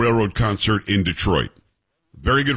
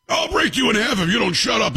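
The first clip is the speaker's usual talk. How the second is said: acted threat; 11 semitones higher than usual. Dad retelling his warning to a drunk heckler, high-pitched threat.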